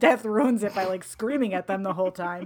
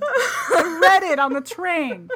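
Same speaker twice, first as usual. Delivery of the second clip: laughing; 7 semitones higher, 6 dB louder, slower than usual. Real, not acted. Laughing while telling wild plot.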